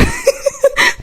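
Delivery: real burst of laughter; laughing. Real, not acted. Storyteller cracking up at her own story, laughing. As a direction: crack up partway through the sentence.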